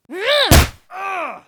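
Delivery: loud high shrieking battle cry; fight cry. Retold or imitated. Acting out the kick with a loud high shriek.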